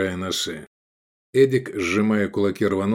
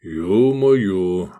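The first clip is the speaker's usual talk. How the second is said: level, unraised exclamation; even voice; 3 dB louder than usual. A narrator's reading. Гефестай sees the praetorians coming, in an even voice. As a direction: keep the oath on an even pitch. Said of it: "ровным голосом сказал Гефестай".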